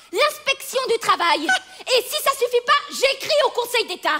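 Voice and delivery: escalating threat. Acted. Tenant threatening to report the landlady, defiant.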